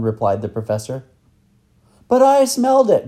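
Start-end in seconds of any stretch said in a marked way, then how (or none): 2.1-3.1 put-on high, loud boy's protest; protesting cry. Boy protesting to the professor that he smelled it, loud cry. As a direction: cry out, insisting on being believed. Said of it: "cried Tom".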